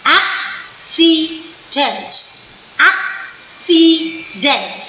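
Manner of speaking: Accident slowly, ak... si... dent; three syllables. Shown as a demonstration. Teacher demonstrating three syllables, accident split slowly. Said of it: "three syllable".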